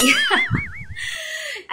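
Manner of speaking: high, bursting embarrassed giggle; embarrassed laugh. Real, not acted. Vlogger laughing at her own twisted bra on camera, a high embarrassed giggle. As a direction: laugh it off, flustered.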